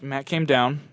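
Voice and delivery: ordinary delivery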